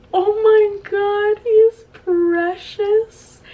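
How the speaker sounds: high, slow gushing squeal. Real, not acted. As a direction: coo over it, high and slow. Gushing over Dobby toy.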